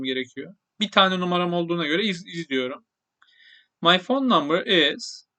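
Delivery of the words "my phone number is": slow model phrase. Shown as a demonstration. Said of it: "teacher models English phrase".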